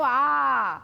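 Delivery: আ held out long; open vowel. Shown as a demonstration. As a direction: sustain আ freely without closing it. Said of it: "আ কি হচ্ছে".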